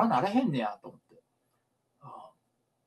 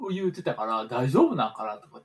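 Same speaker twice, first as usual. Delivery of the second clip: usual speaking voice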